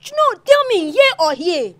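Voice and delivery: high, loud challenge for an answer; heated demand. Acted. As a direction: shout the question, pressing hard.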